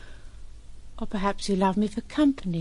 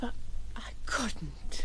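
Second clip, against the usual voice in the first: whispered confession; 7 dB quieter, slower than usual. Acted. Admits she couldn't sleep.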